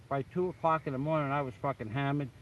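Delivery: usual speaking voice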